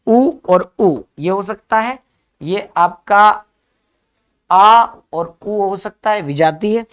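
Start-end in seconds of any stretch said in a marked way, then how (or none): 0.1-2.0 उ and उ said slowly and loud, higher; vowel demo. Shown as a demonstration. Teacher lists matching vowels for students, slow and raised. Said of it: "चलिए". 4.5-6.2 आ and उ said slowly and loud, higher; vowel demo. Shown as a demonstration. Teacher contrasts two vowels for students, slow and raised. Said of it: "चलिए".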